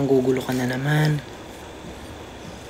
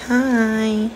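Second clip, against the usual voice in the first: cat's voice; 9 semitones higher than usual. Pretend. Answering for the cat.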